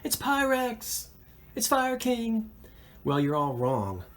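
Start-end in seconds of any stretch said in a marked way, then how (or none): 0.0-2.5 much higher, lightly voiced mock shouting viewers; mock viewers yelling. Host imitating viewers shouting guesses at the screen, high mock yell. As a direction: playfully imitate the audience calling out guesses. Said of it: "Everybody's yelling at the screen".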